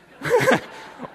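sudden high burst of laughter; real laugh. Real, not acted. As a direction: break into laughter. Laughs at Tim's confession.

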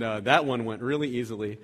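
ordinary talk, nothing marked

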